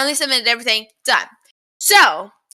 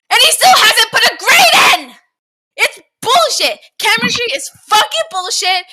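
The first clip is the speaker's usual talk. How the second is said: loud furious shouting; angry rant; 8 semitones higher, 6 dB louder than usual. Real, not acted. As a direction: yell it in outrage. Ranting about professor.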